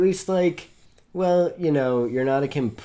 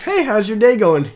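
higher, louder cheery greeting; mock friendly local; 9 semitones higher, 9 dB louder than usual. Friend imagines the hotel guest's greeting, higher and louder. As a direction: chirp it like a friendly stranger. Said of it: "it was probably like".

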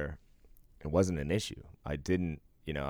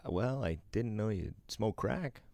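slower, higher mock-mom line; a hint of mom, drawn out and raised; lightly voiced mom; 7 semitones higher, slower than usual. Mom reacting to the podcast, lightly imitated. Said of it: "And then my mom goes".

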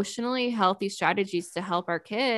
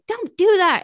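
high, loud sharp command; an annoyed parent shutting a child down; snapping parent; 9 semitones higher, 7 dB louder than usual. Retold or imitated. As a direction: bark the order at the kid. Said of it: "you're not just like".

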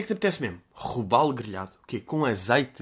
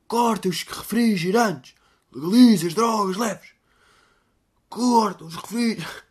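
mock slogan; 6 semitones higher, slower than usual.

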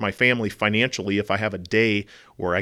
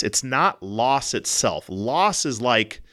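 ordinary talk, nothing marked

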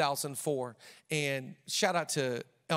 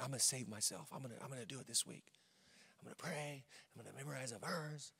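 very soft mimicked self-talk; mock inner resolve; 12 dB quieter than usual. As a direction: murmur it like a private self-promise. Voicing someone's private resolutions.

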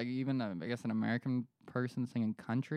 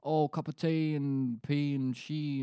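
drawn-out mock British accent; 4 semitones higher, slower than usual. Podcaster showing how Brits talk, mock British accent. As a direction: parody a British accent, slowly. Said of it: "hear a British person".

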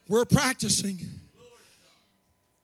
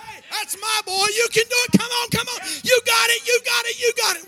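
yelling from the sidelines; 9 semitones higher than usual. Retold or imitated. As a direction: scream for your grandson rounding third. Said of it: "You jump up and say".

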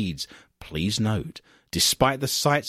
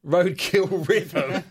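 laughing; 3 semitones higher, much slower than usual. Real, not acted. Repeats title laughing.

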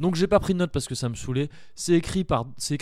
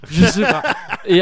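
laughing; 4 semitones higher, 10 dB louder than usual. Real, not acted. Laughing at own rant.

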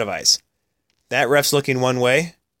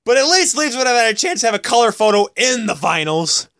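excited rant; 9 semitones higher, 4 dB louder than usual. Real, not acted. Teasing rant about Leafs.

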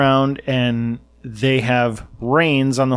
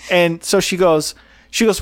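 usual speaking voice

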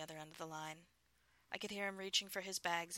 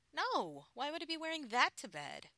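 mock protest; 10 semitones higher, 8 dB louder than usual. A narrator's reading. Wife protesting, high and loud.

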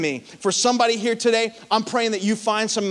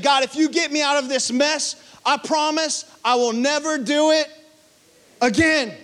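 higher, pleading bargain with God; desperate prayer; 8 semitones higher than usual. Retold or imitated. Preacher voicing a desperate believer, pleading higher. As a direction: beg God to get you out of trouble. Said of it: "You ever prayed that prayer?".

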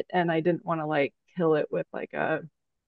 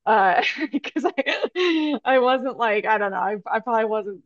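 real embarrassed laugh through the words, higher pitch; talking through a laugh; 4 semitones higher than usual. Real, not acted. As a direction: laugh while confessing, voice lifting high. Admits getting fired, laughing.

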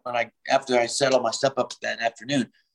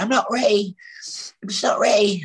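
high, whiny mock diva; a hammed-up singer crying off the show; 11 semitones higher than usual. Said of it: "I did this whole video where it's just like".

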